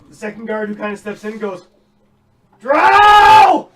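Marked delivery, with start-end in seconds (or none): 2.6-3.7 very loud, high alarm scream; guard's all-out scream of alarm; full-force scream. Acted. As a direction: scream it as loud as you can. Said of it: "just screams it as loud as he can".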